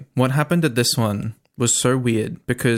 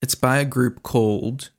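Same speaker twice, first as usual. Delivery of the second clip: ordinary talk, nothing marked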